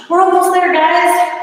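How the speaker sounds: high, loud coach push; rally the class to finish; loud encouragement. Real, not acted. Coach urging class at set end.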